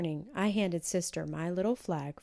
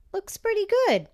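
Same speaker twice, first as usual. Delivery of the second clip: put-on much higher, louder delighted child; high pleased child voice; little girl; 9 dB louder, faster than usual. A narrator's reading. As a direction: savor the moment like a proud girl.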